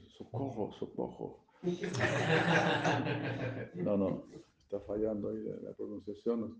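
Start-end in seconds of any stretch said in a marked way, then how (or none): 0.1-1.5 feeble cry for help. Retold or imitated. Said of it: "Y gritas socorro".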